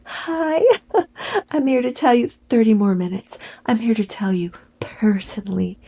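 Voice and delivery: high flirty mock, ending in a whisper; mock-flirty hello fading to a whisper; mocking Megan. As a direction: coo it breathily to the contestants.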